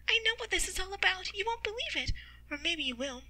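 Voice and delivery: bubbling news in a high character voice; excited Shireen. Acted. Shireen speaks with regained voice.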